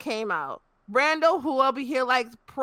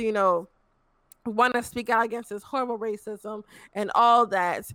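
ordinary talk

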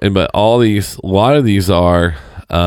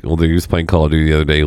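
usual speaking voice

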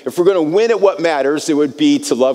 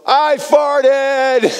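yelled; 11 semitones higher than usual. Retold or imitated. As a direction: shout it out to the whole room. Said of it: "I just yelled".

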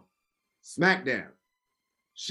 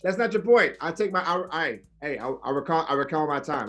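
ordinary talk

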